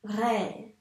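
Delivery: RÉ with a long R and held vowel; teacher's drawn-out RÉ; sounding out RÉ. Shown as a demonstration. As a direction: stretch both sounds of RÉ. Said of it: "il faut bien dire tous les sons".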